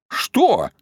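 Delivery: high, sharply falling cry; exclaimed question. A narrator's reading. Grandmother demands an explanation from parents, crying out. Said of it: "крикнула бабушка Марья".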